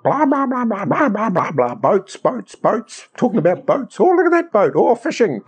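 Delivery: high-pitched, excitable babbling; hammed-up chatter, leaping up in pitch; nonstop prattle. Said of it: "I'm more verbal than my wife".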